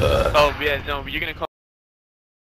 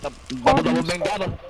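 excited; 8 semitones higher, slower than usual. Real, not acted. Excited callout.

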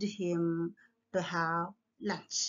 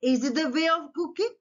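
quick, higher-pitched challenging question; mock interrogation; 6 semitones higher, faster than usual. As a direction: fire off the question, lifting it at the end. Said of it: "He interrogated about this".

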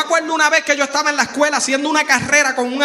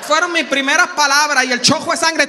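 usual speaking voice

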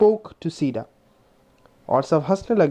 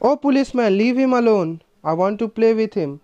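hot reply; 6 semitones higher, much slower than usual. A narrator's reading. Leela defends her friend to the policeman, hot angry demand. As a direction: order the policeman off him. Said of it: "Leela replied hotly".

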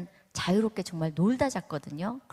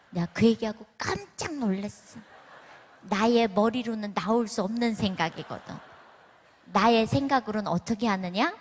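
ordinary delivery

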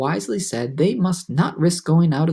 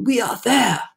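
put-on higher old grasshopper voice; put-on upbeat character voice; trying to be cheerful; 7 semitones higher than usual. A narrator's reading. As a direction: announce it with forced cheer. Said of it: "trying to be cheerful".

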